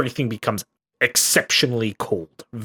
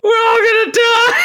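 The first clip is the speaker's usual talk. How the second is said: high, loud laughing panic scream; shouting over fire alarm; 6 dB louder than usual. Pretend. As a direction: shriek it through laughter.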